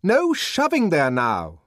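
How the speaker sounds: high, loud, slow angry order; furious shout. A narrator's reading. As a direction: shout down a jostling crowd. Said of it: "shouted the deputy sub-bursar in a fury".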